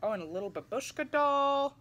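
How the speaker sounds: ordinary delivery, nothing marked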